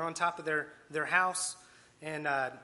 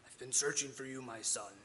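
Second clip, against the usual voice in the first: thunderous God voice; 4 semitones lower than usual. Acted. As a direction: lightly act out a deep, solemn God calling. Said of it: "booming, thunderous voice".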